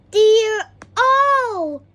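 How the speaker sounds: high, loud dismayed cry; oh dear, exclaimed loudly; exclaiming. A narrator's reading. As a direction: shout out your alarm. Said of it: "he exclaimed".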